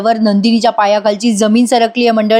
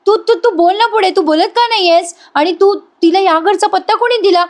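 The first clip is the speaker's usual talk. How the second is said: higher, pressing questions to a friend; lightly voiced anxious young wife; Nandini questioning Arya; 7 semitones higher than usual. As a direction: demand answers, puzzled and urgent.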